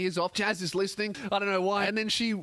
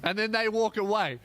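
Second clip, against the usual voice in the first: normal talking voice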